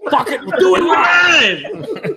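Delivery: high shouted angry rant; Bill O'Reilly rant. Retold or imitated. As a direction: yell it like a blowing-up anchor. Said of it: "Bill O'Reilly".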